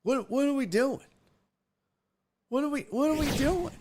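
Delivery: high-pitched, baffled squeal of a question; mock disbelief. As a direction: ask it way up high, utterly baffled. Baffled at the breakdancer's name and look.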